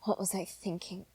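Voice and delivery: ordinary talk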